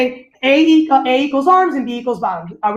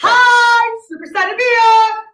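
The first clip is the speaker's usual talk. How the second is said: high, loud, slow cheery hello to a student; bright, hyped class opener; excited teacher greeting; 10 semitones higher, 5 dB louder, much slower than usual. Shown as a demonstration. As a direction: greet the kid like a dance teacher full of energy. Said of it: "demonstrate what it will look like".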